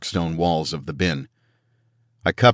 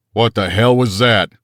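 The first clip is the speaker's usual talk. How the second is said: loud alarmed yell; 4 semitones higher, 5 dB louder than usual. A narrator's reading. Soldier yelling after hearing a roar, loud. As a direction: shout it in alarm. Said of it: "Top yelled".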